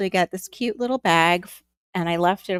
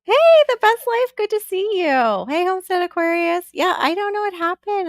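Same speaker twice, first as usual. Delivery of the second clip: high, loud, bubbly hello to a viewer; light up and call out the welcome; excited greeting; 10 semitones higher, 6 dB louder than usual. Real, not acted.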